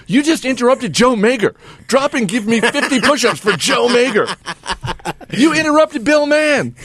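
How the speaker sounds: high barking drill sergeant. Retold or imitated. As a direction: bark orders at recruits. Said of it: "and he'll say".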